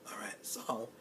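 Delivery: whispered back to the story. Real, not acted. Whispered return to the story for the crowd. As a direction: drop to a whisper.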